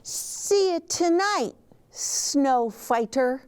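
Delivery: high, anxious little truck voice; Gus voice. A narrator's reading. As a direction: squeak it out nervously as little Gus.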